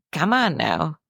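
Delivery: usual speaking voice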